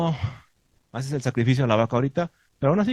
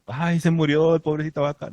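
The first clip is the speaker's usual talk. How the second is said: mock weepy lament, higher and louder; mock whiny mourner; 6 semitones higher, 4 dB louder than usual. Teacher mocks a crybaby mourning the slaughtered cow, mock weepy. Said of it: "la persona chillona".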